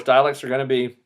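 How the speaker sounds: ordinary talk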